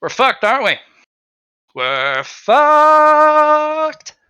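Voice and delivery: the bard loses it completely; panicked wail. Acted. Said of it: "But the bard's like".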